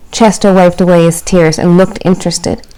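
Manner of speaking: storybook narration for little ones; reading to children. A narrator's reading. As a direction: read it like storytime.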